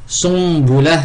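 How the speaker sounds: Sumbula with the n turned into m before the b, sum-bula; iqlab. Shown as a demonstration. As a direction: close the lips early: Sumbula, m not n. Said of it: "l'iqlab, nun sakina, ya un ba".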